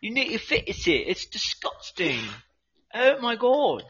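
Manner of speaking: high, scolding put-on accent; hammed-up appalled rant; mock disgust. Berating the smelly partner, mock disgusted rant. Acted disgust in improvised commercial skit.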